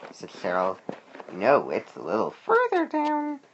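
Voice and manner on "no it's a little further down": high, slow bird voice; bird character. A narrator's reading. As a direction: answer slowly in a high-pitched bird voice. Bird replies to the worm.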